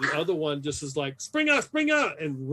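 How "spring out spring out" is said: high, frantic yelp of a fleeing man; panicked cry. Acted. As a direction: squeal it out in panic. Fleeing soldier cries out in panic.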